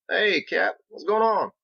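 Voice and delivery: high, loud friendly country hail; Biggs calling down. Acted. Biggs calling down to Captain, high and friendly. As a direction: call down cheerfully from the scaffold.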